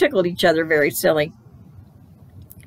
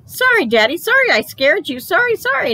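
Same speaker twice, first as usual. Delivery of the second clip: very high repeated little apologies; apologetic child; 12 semitones higher, 3 dB louder than usual. A narrator's reading. Child apologising for scaring daddy, high pleading voice. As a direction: pipe up, rushing to say sorry.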